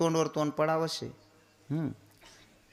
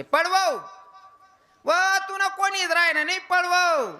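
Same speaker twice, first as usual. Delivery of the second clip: high, loud nagging scold; scolding old woman; 7 dB louder than usual. Retold or imitated. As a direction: scold the baby while rocking the cradle. Old woman scolding, rocking cradle. (म्हातारीचा उंच, मोठ्याने कुजका टोमणा)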